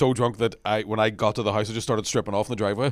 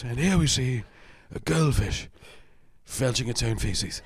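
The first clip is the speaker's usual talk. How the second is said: measured nature-documentary narration; David Attenborough; 4 dB quieter, slower than usual. Acted. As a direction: narrate slowly with pauses between phrases, as in a wildlife film. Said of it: "you don't see in the David Attenborough documentaries".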